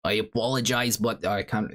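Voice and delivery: put-on apologetic Zelensky-style Ukrainian accent. Streamer apologizing to chat on request, in Zelensky's Ukrainian accent. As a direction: attempt a Ukrainian accent like the president's. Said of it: "please do the Zelensky accent again".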